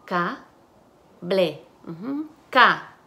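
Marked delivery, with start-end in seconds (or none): 0.1-1.7 "Ca, ble" as two syllables with a clear gap. Shown as a demonstration. Teacher segments the word for students, syllable by syllable. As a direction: break "Ca, ble" apart at the syllable. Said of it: "Y las sílabas".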